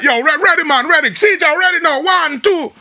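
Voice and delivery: loud, high-pitched mock engineer call; imitated studio holler, urging the singer on; impatient studio engineer. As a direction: shout the count-in like a rushed engineer. Imitates rushed Jamaican studio engineer.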